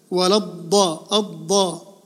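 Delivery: الضاء slowly, held heavy D; doubled dad. Shown as a demonstration. Teacher isolating the doubled letter, held D in الضاء. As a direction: close on the D of الضاء, then release. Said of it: "الضاد مشدد".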